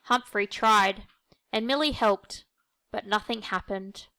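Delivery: ordinary delivery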